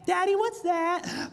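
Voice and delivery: put-on high, slow child's question; little kid voice. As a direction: ask like a curious toddler. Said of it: "start asking questions".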